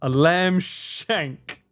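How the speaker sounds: very slow, drawn-out read of the menu item; slow, savouring read-out. Real, not acted. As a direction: stretch every word out. Reading a pub menu item.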